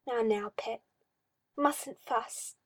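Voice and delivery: a hint of a creepy, cooing master; mildly sinister coaxing; lightly voiced oily magister. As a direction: purr it like a condescending owner.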